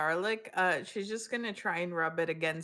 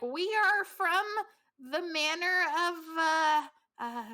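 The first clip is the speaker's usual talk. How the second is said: haughty lady; 10 semitones higher, 3 dB louder than usual. Acted. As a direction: announce herself with pomp. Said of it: "be like".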